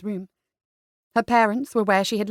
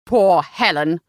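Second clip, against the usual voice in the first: low, loud, scornful "Poor Helen"; disgusted father's mocking echo; spat; 4 semitones lower, 5 dB louder, slower than usual. A narrator's reading. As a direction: spit it out with contempt. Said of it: "spat Sir William".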